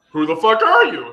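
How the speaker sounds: loud, high-pitched demand; blunt challenge. Retold or imitated. Messaging an unknown connection, loud blunt challenge. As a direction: throw it out as a mock-confrontational demand.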